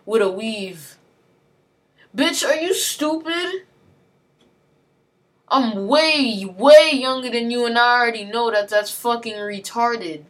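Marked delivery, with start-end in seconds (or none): 2.1-3.7 drawn-out, higher mocking question; slow scornful disbelief, pitched up; incredulous scorn. Real, not acted. Ranting at someone who lectured her. 5.5-10.2 louder, heated rise on way younger; angry outburst. Real, not acted. Ranting at someone who lectured her.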